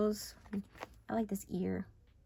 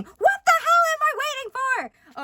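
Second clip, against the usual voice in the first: very high, loud frustrated yell; exasperated outburst; 10 dB louder than usual. Real, not acted. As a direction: explode in outrage. Exasperated outburst in rant, then apologizes.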